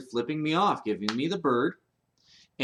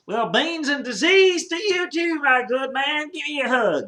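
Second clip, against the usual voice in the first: much higher, loud hammed-up cowboy voice; Texan man; 8 dB louder than usual. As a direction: play the jolly Texan mishearing the greeting. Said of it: "And the man turns".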